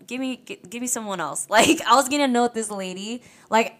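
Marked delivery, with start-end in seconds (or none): 0.1-1.5 insistent demand. Retold or imitated. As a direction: insist on another waiter, half-heated. Demanding a different waiter.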